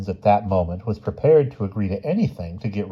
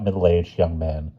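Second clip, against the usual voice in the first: plain reading voice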